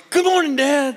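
put-on eager boy. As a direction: pop up with a chirpy good morning.

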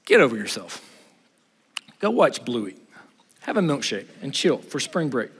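low, soft, unbothered voice of God; God's deadpan reply. Retold or imitated. God answering Elijah's complaint, low and deadpan. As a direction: shrug it off low and quiet. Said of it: "what was God's reply to him".

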